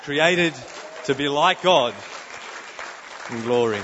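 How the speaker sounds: loud, ringing preacher's declaration; forceful proclamation. Real, not acted.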